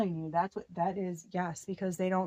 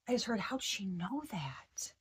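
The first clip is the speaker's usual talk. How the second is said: whisper; 6 dB quieter than usual. Retold or imitated. Relaying an overheard voice.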